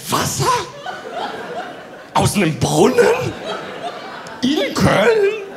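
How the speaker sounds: mock disbelief. Comedian mocks friend's news with rising disbelief. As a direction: build from doubt to disbelief.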